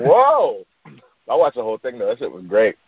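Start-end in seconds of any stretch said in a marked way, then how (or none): 0.0-0.6 much higher, drawn-out whoa; mock stunned viewer; amazed whoa. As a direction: gasp it in disbelief. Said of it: "I was like".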